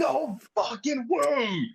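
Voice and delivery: high, stretched-out cry of amazement; stunned disbelief. Retold or imitated. Fan retelling the moment that gong hit, high drawn-out disbelief. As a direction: wail it slowly and high, like an astonished fan.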